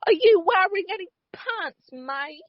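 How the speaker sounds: put-on high British accent, cheeky and teasing; British Martin Freeman voice, pitched well up; Martin Freeman impression. Cohost teasing Sherlock in a high British accent. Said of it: "That's your Martin Freeman impression?".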